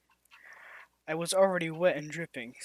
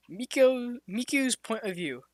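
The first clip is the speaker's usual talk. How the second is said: plain reading voice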